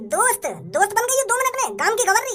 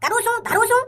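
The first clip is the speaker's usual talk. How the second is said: mocking Haryanvi. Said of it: "हम जैसी भाषा में बात कर".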